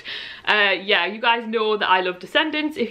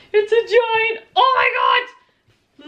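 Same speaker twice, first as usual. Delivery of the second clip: high-pitched, tearful shout of amazement; real excited cry; 11 semitones higher, 4 dB louder, much slower than usual. Real, not acted. Fan finding the package is a giant book, crying out excitedly. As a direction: cry out high, stretching the last words.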